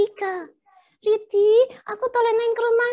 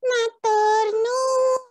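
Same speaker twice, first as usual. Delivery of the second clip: slow polite Javanese; much slower than usual. Acted. Polite Javanese reply to prompt.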